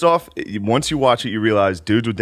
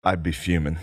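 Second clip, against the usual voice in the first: low flat answer; 6 semitones lower, 5 dB quieter than usual. Retold or imitated. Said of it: "he goes".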